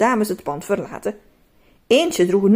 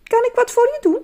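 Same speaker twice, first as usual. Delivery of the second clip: high, sweet helpful question; sweet shopkeeper; 14 semitones higher, 4 dB louder, faster than usual. Acted. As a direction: kindly offer to help a customer. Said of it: "zei een lieve stem vragend".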